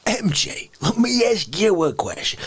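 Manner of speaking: ordinary talk, nothing marked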